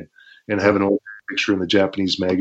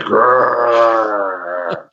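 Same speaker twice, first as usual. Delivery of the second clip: long drawn-out "grrr"; imitated growl. Wrestler refusing to show the kick hurt, mock growl. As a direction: growl through the pain in mimicry. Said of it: "So I'm just like".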